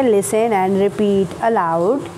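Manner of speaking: Listen and repeat aloud, drawn out very slowly; very slow instruction. Shown as a demonstration. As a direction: spell out the instruction word by word. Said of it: "teacher instruction". (बहुत धीरे-धीरे निर्देश)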